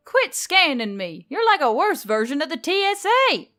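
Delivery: loud, high, exasperated; indignant yell. Acted. Emily yelling at the robot to stop scanning, loud. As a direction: shout him off.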